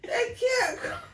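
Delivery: high squeaky mock lament, laughing through it; mock wail. Host mock-mourns Cornbread's death in a high laughing wail.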